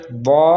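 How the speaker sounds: दौ with a thick d and rounded aw; heavy letter. Shown as a demonstration. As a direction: fill out दौ, long and back. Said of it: "मोटा हर्फ है".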